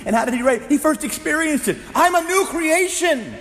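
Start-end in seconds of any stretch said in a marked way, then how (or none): 1.9-3.3 much higher, louder, slow exultant shout. Retold or imitated. Preacher voicing Paul proclaiming his new life, loud shout. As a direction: shout it out as an overjoyed discovery. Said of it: "And he shouted it out".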